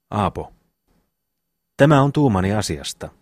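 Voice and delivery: ordinary delivery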